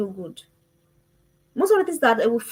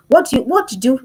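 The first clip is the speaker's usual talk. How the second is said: ordinary delivery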